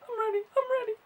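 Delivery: high, soft nervous squeak; squeaky psyched-up. Real, not acted. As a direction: squeak it like you are bracing yourself. Psyching up before opening.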